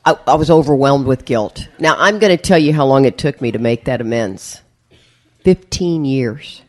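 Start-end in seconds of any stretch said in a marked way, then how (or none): 5.4-6.6 slow emphasis. Real, not acted. Emphatic reveal.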